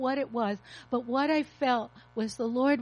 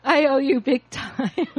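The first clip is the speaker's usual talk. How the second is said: laughing; 6 dB louder than usual. Real, not acted. Laughing to husband in audience.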